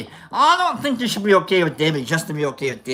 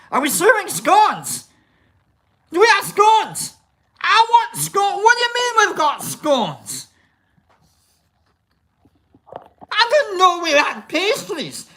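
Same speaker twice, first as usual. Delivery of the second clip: giddy, much higher, firing off questions; excited about scones; 11 semitones higher than usual. Real, not acted. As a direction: squeal over the scones.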